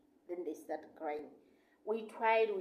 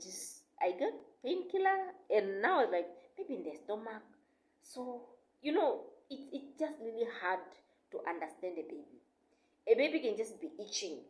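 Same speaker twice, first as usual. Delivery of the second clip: ordinary delivery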